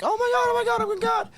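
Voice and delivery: imitating panicked girls, high and shrill; girls freaking out. Coworkers panicking at the fryer, high squeal. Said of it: "all the girls were like".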